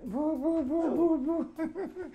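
high, playful boo-boo sounds; playful cooing. Acted. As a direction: coo it high and teasing. Playful teasing with the maid.

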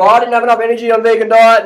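mock a quitter's excuse; whiny ex-vegan. Mocking ex-vegan complaint.

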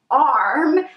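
ordinary talk

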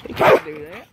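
ordinary delivery

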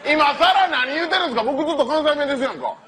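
ordinary talk

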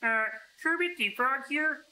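high, slow puppet frog voice; Kermit the Frog. Retold or imitated. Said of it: "Got that perfect Kermit the Frog voice".

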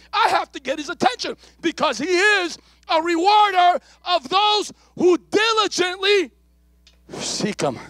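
high, loud preaching; high, loud sermon peak; preaching climax. Acted. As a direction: lift it into a cry.

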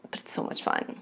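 unvoiced whisper. Real, not acted. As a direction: whisper it like a secret. Sudden hushed confession.

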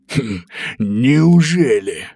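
slow mocking wolf. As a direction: drawl it like a sly wolf. Wolf mocks the pig.

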